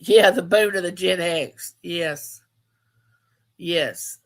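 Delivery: ordinary talk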